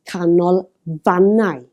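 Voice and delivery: canolfannau broken up with "fan" emphasised; stress on second-last syllable. Shown as a demonstration. Teacher pointing out the emphasis, canolfannau stressed on "fan". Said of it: "the last syllable but one again".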